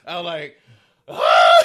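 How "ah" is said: loud low victory yell; primal scream. Retold or imitated. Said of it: "you just had to let out a primal scream".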